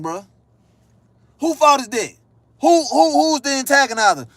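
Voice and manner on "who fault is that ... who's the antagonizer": higher-pitched, worked-up questioning; indignant challenge. Acted. Arguing over who antagonized whom, indignant challenge. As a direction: demand to know who started it.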